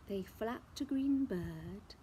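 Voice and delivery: ordinary delivery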